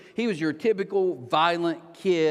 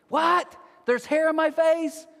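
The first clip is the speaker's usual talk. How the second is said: high, drawn-out mock disbelief; incredulous outburst; 11 semitones higher, 4 dB louder, slower than usual. Disciples in the storm answering Jesus, incredulous high outburst. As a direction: blurt it back, pitched way up.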